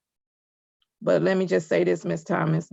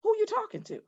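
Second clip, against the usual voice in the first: much higher, softer sassy mimicry; mock smart-mouthed challenge; 14 semitones higher, 7 dB quieter, faster than usual. Judge shows she can talk like that too, sassy. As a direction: toss it off high and soft, imitating back-talk. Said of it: "I can talk like that too".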